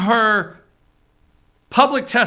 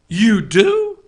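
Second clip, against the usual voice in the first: imitating Chuck Todd; 4 dB louder than usual. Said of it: "Chuck Todd reactions".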